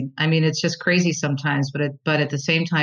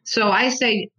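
normal talking voice

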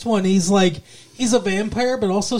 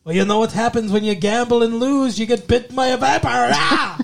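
higher, hammed-up Irish menace; mock Irish threat ending in a cry; wobbly Irish accent; 4 semitones higher than usual. Vampire bookie threatening a gambler who lost, mock Irish accent. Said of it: "all over the place with that accent".

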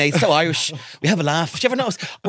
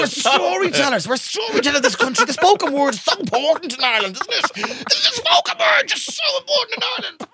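higher, sing-song, mock tearful patriotism; overblown Irish sentiment; 10 semitones higher than usual. Hamming up Irish pride to the others, mock tearful. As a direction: gush about Ireland until it cracks into a fake sob. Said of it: "Stop it".

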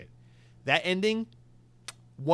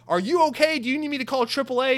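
much higher, louder worried questions; alarmed concerned driver; 10 semitones higher, 5 dB louder than usual. Retold or imitated. Asking the screaming driver if he needs help, alarmed. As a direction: play it panicky and concerned. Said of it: "I'm like".

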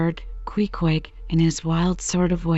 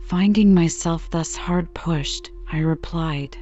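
ordinary delivery

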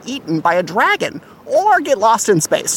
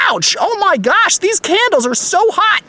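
mock pain; 8 semitones higher than usual. Burned by hot candles.

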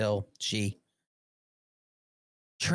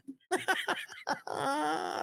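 high burst of laughter; 13 semitones higher, 5 dB quieter than usual. Real, not acted. Laughs at Trump's post.

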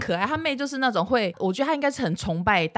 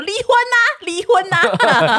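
higher, louder, with the second one drawn out slowly; mock shout; 10 semitones higher, 8 dB louder, slower than usual. Wife imitating husband shouting divorce during fights, mock yell. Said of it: "因为我老公很爱说".

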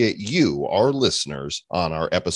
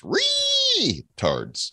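mock crying. Fake sobbing joke ending.

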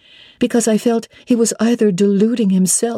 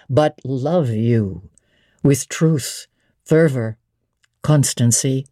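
lower, steady male voice for Mr Rochester; 4 semitones lower than usual. Acted. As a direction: drop into the master's lower register, assured. Rochester voiced by female narrator.

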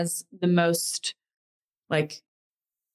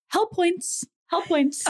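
mom's warning; 10 semitones higher, slower than usual. Retold or imitated. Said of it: "she puts her finger up and she goes".

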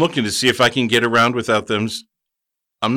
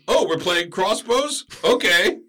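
high, slow, put-on taunting voice; cocky wizard; 8 semitones higher, slower than usual. Wizard taunting the bandit before shooting, high slow mock voice. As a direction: taunt the bandit, drawing out the words. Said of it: "I say".

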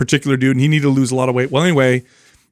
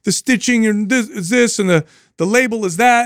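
put-on higher-pitched retelling of a child protesting; imitated daughter; 9 semitones higher than usual. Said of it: "She's like".

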